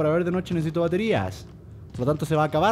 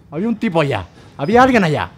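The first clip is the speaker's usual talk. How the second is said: loud, raised, excited shout; alarmed calling out; 6 semitones higher, 9 dB louder than usual. Real, not acted. Player telling a lurker they are caught on camera, loud and high. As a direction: call out loudly to the lurker. Spots someone, calls out to them.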